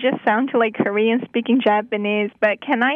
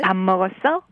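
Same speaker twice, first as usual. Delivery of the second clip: Seoul-accent question, lower voice; 4 semitones lower, much faster than usual. Shown as a demonstration. Guest repeating the Seoul version for host, Seoul accent. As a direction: repeat it as Seoul people would. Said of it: "like, in Seoul".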